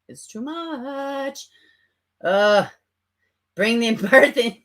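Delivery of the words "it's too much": slow, drawn-out "It's too much."; exasperated and slow. Real, not acted. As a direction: stretch every word in disbelief.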